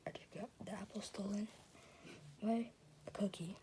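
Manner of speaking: very soft, hushed; defeated whisper. Acted. Emmett whispers defeat about the stolen apple.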